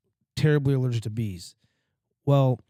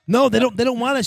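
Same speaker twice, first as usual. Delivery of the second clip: high, loud urgent plea; frantic son stopping his mom; frantic protest; 10 semitones higher, 6 dB louder than usual. Retold or imitated. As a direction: beg mom to stop, panicked.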